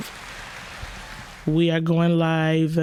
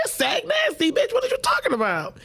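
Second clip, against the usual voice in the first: much higher, fast indignant correction; mock outrage; 8 semitones higher than usual. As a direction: snap back at her like the whole crowd is offended. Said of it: "We like".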